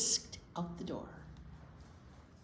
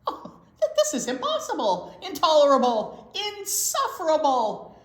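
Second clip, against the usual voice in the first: loud, higher furious bear; shaking with anger; 7 semitones higher, 8 dB louder than usual. Acted. Bear outraged that the mouse is back again. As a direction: sputter the words out in outrage. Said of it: "shaking with anger".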